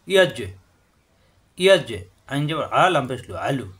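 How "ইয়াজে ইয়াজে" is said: ইয়াজে with a bounced জ; qalqalah bounce. Shown as a demonstration. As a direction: release the জ in ইয়াজে with a short echo. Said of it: "জিম সাকিন কলকলা".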